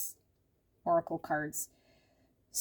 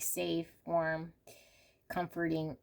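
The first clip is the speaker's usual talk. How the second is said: normal talking voice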